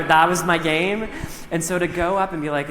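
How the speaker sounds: ordinary delivery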